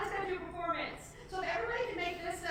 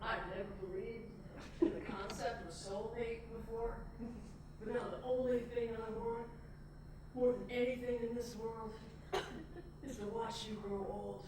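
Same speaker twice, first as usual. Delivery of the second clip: low, soft, earnest husband voice; groom's wedding vows; 5 semitones lower, 5 dB quieter than usual. Acted. Paul vowing to grow old together, low and solemn. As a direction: pledge it quietly, pausing between phrases. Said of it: "There's Paul. Stop it there".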